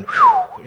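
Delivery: a sharp, high, loud whistle of relief; cartoonish relief whistle, high and loud; high relieved whew. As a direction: blow out a big squeaky whew. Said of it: "I've said".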